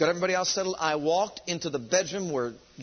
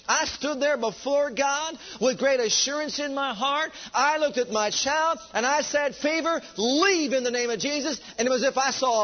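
preaching at full force; 7 semitones higher, 4 dB louder than usual. Acted. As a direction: proclaim it with full conviction.

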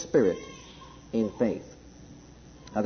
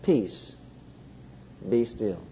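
much softer and lower, hushed and calm; 6 semitones lower, 7 dB quieter, slower than usual. A narrator's reading. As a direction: say it like stilling a storm, gently. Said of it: "very calmly says".

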